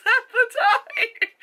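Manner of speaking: high, slow, breaking into laughter; words squeezed out through helpless laughter; laughing. Real, not acted. Vlogger remembering eating tacos, laughing hard. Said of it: "laughing at her own tacos".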